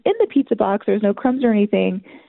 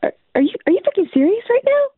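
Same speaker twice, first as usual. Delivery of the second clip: rising mock disbelief; mock his catchphrase; mild imitation of the husband; imitating husband; 6 semitones higher than usual. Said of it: "you're like".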